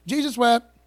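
tossed off loudly like a schoolyard joke; imitating a classmate smirking at the preacher's kid; kids' mocking taunt. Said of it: "Everybody used to tell me that verse in middle school".

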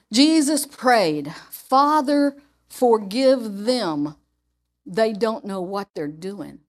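slowed scripture reading with a hushed finish; slow, hushed quote of Jesus praying. Retold or imitated. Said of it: "this is out of the Message".

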